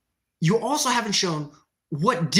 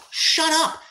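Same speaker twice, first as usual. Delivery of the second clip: angry snap; 9 semitones higher than usual. Real, not acted. Streamer snapping at a useless commenter, angry. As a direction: slam the door on it.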